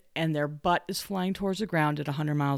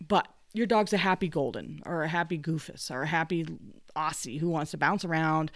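normal talking voice

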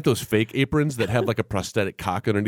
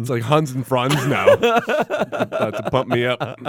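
slow laughing remark; 2 semitones higher, much slower than usual. Real, not acted. Referencing Hans and Franz, laughing.

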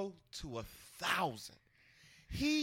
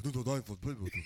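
Greg's way of talking; 6 semitones lower, much faster than usual. Retold or imitated. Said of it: "You know how Greg talk".

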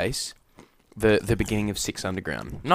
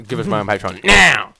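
louder, laughing repeat of the plea; loud plug; 10 dB louder than usual. Real, not acted. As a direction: shout the Patreon plug.

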